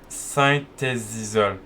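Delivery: very slow, stretched syllables; hesitant sounding-out. Real, not acted. Player reads out a hard name, halting and unsure. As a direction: feel your way through an unknown name. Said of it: "je sais pas comment on dit".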